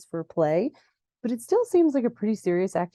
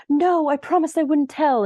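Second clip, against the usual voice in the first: loud, high-pitched mock protest; hammed-up insistent cry; 8 semitones higher, 6 dB louder than usual. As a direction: imitate an anxious objection, pitched up. Jenny protests revealing the secret.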